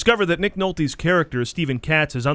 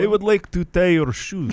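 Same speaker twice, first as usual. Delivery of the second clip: hammed-up eighties action-movie Russian villain; Russian villain accent; 4 semitones higher than usual. Performing a co-host's requested line, mock Russian villain accent. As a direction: put on a higher Russian bad-guy accent. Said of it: "as a Russian villain?".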